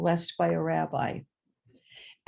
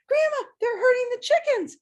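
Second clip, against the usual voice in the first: put-on much higher, pleading little-girl voice; upset child; 5 dB louder than usual. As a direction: cry out to grandma in alarm.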